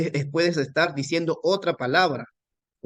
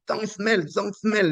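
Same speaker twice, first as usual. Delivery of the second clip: much higher-pitched, insistent retold scolding; mock annoyed; 8 semitones higher, much slower than usual. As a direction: repeat it as an exasperated beginner in English.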